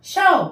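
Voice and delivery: "शौ" loud and high, with the au vowel; "शौ" as one ringing syllable; model pronunciation. Shown as a demonstration. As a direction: sound out "शौ" emphatically for learners. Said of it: "इसका उच्चारण कैसा हो जाएगा?".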